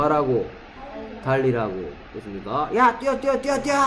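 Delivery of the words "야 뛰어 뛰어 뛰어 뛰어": high-pitched mock yell; high, urgent acted shout. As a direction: yell orders like you're urging a runner on. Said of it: "소리 지른단 말입니다".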